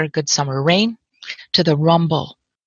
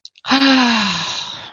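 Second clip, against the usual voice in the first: long, airy exhaled sigh; slow sigh of release; 7 semitones higher than usual. Real, not acted. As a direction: let out a big audible breath. Long sigh after ending story.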